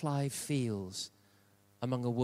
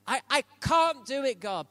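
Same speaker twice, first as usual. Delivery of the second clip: mock reluctant protest; 11 semitones higher, 6 dB louder than usual. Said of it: "things that God says that will annoy you".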